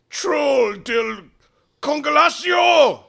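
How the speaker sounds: high, loud spell shout; yelled incantation. A narrator's reading. Thaddeus casting a spell at the troll, yelling. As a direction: shout the spell, drawing out the last word. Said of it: "and he yells".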